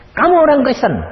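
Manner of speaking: high, loud pointed challenge; challenging question. Retold or imitated. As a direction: fire the question back. Orang Buddha menyerang balik. (menantang, tinggi dan keras)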